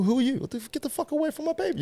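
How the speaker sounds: high, fast mock outrage guarding the baby; hammed-up overprotective parent; mock protective. Protective of his event, his baby.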